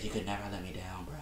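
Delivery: mock a soft, pleading star athlete, barely voiced; hushed mock-sentimental quote; soft-voiced LeBron James. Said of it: "Why did your voice go up an octave".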